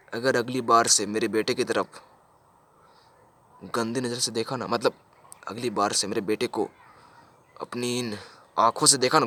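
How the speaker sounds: low, soft acted threat; quiet menace. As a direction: drop the voice to warn him. Father threatens teacher in lowered voice.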